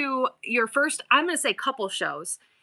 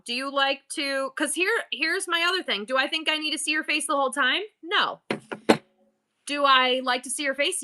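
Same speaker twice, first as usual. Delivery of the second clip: ordinary delivery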